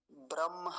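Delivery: ब्रह्म as bram-haa, m before h; mistaken pronunciation. Shown as a demonstration. Teacher quoting people's common error, mistaken pronunciation. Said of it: "ये गलत है".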